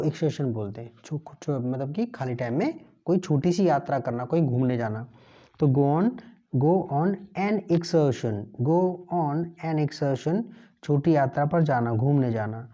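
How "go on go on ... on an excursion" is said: drawn-out model phrase; slow drill. Shown as a demonstration. Teacher slowly drills the phrase for learners. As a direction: say "go on an excursion" very slowly. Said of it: "teacher drills phrase slowly".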